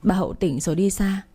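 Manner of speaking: plain reading voice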